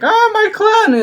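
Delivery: high, loud hammed-up wail; mock wail. Mocking the TV gamer's reaction: high hammed-up wail. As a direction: parody the fake whining gamer. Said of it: "we don't start like".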